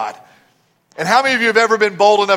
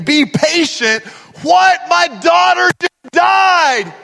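high, slow disbelieving cry, breaking into a shout; a father's inner protest, rising into a shout; anguished outburst; 11 semitones higher, slower than usual. Retold or imitated. Jairus hears his daughter died, crying out in anguish. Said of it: "on the inside saying".

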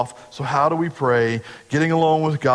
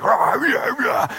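high, hammed-up pump-up yell; mock a red-faced coach's rah-rah; imitating a basketball coach firing up players; 10 semitones higher, slower than usual. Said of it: "from him yelling".

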